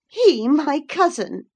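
high, loud, scoffing disbelief; scornful cry. Acted. Girl rejects the lad as her cousin, scornful. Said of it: "with a scornful laugh".

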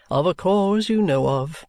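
weary resentment held in check; bitter guardian. A narrator's reading. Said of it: "returned my guardian bitterly".

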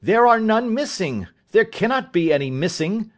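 high, insistent protest; acted accused son, agitated; indignant denial. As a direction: protest your innocence, pitch jumping up.

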